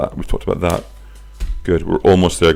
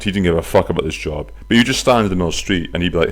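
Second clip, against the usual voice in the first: usual speaking voice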